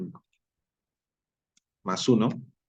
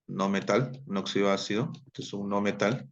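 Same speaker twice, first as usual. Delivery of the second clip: ordinary talk, nothing marked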